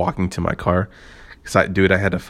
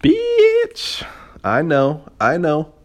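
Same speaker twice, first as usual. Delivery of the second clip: high put-on sassy girl voice, louder; mock sassy girl voice; 9 semitones higher, 4 dB louder, much slower than usual. Podcaster opening with a sassy girl voice. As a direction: squeal it like a sassy girlfriend.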